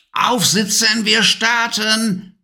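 high, slow witch's order; commanding witch. A narrator's reading. Witch orders Levi onto the broom, high and commanding. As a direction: bark the order like a bossy witch. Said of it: "kommandierte sie".

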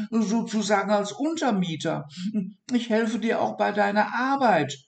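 high, asking a favour; high pleading dormouse; hopeful dormouse. Acted. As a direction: ask hopefully to move in. Dormouse asks to lodge.

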